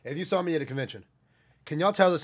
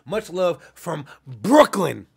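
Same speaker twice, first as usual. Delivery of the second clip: put-on drawn-out, loud Brooklyn accent; slow, hammed-up New York accent; 9 dB louder, much slower than usual. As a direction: lean hard into a Brooklyn accent. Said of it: "the way I said Brooklyn".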